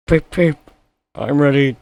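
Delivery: high, loud eager toot; cheerful little engine tooting; eager Edward. A narrator's reading. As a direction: toot brightly, ready to go.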